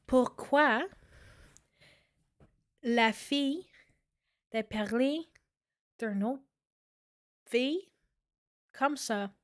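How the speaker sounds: Quebec French; spoken in French, Quebec accent; speaking French. Shown as a demonstration. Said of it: "I actually speak French".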